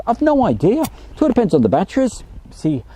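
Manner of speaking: put-on playful Birmingham accent answering a viewer's question. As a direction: slip into a jokey Birmingham accent. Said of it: "what accent was that? That was more, um, Birmingham".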